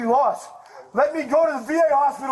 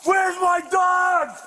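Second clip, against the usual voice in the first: high, drawn-out yell; slow desperate shout; 6 semitones higher, much slower than usual. Real, not acted. Suspect yells slowly at officers demanding his dog.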